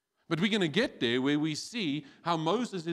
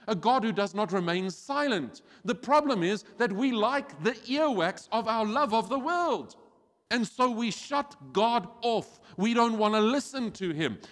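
fiery preaching; 5 semitones higher, 4 dB louder than usual. Acted. Preaching at full force.

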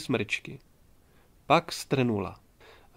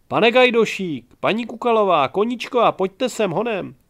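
crying out; 8 semitones higher, 7 dB louder than usual. A narrator's reading. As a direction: yell for the others to come quickly. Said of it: "vykřikla". (hlasitý vysoký výkřik o pomoc)

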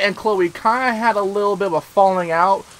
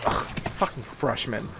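breathy voiceless sneer, much lower and softer; contemptuous sneer; 11 semitones lower, 14 dB quieter than usual. Retold or imitated. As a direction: mutter it with scorn like a snooty upperclassman. Mean girl sneers at them.

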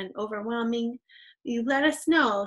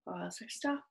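softly murmured prompt; hushed aside to pupils; quiet reminder; 11 dB quieter than usual. Shown as a demonstration. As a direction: drop well down for the cue. Soft prompt at each period.

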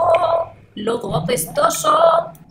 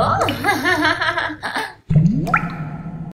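witch cackle. Acted. Witch laughs at the children.

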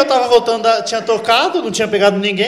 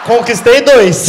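louder, slow; triumphant shout; 3 semitones higher, 8 dB louder, much slower than usual. Retold or imitated. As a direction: crow the win. Triunfo tocando para os policiais. (grito triunfante, lento)

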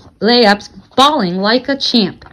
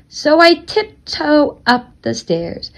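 slow, higher, word by word; stealthy storytelling, stretched with small pauses; tiptoeing narration; 4 semitones higher, slower than usual. A narrator's reading. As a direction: creep through it one careful step at a time. Narrator acts out creeping upstairs.

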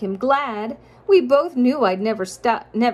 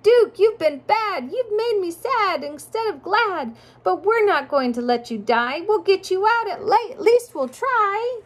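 Sam crying out; 10 semitones higher than usual. Acted. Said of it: "Sam cried".

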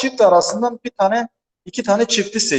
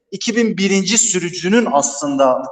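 normal talking voice

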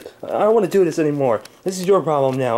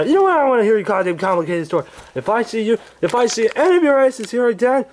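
Angry Grandpa voice; 9 semitones higher than usual. Acted. Old man woken by pranksters, high cranky yelling. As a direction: bark at the intruders like a furious grandpa.

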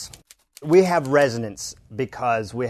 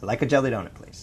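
American accent model; faster than usual. Shown as a demonstration. Coach models American accent line for learner, falling end. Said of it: "let's just say the line".

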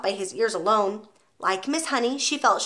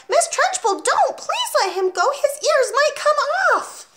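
very high, pleading outcry; frantic, high-pitched plea; alarmed cry; 4 dB louder, slower than usual. A narrator's reading. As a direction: cry out to stop the cruelty. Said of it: "Miss Honey cried out".

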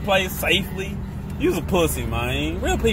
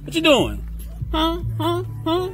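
mock confrontation; 11 semitones higher than usual. Playfully confronting kids.

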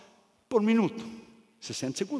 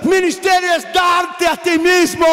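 shouted, higher preacher's cry, each line hammered; preaching at full force; 6 semitones higher, 7 dB louder than usual. Acted. Preacher shouting to the congregation what ministry is, full-force preaching. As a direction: preach it hard, building to a roar.

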